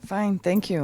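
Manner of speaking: normal talking voice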